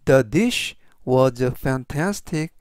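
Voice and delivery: very slow, careful English; slow model sentence. Shown as a demonstration. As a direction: read it slowly for learners. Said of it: "전체적 의미는". (아주 천천히 또박또박 읽는 예문)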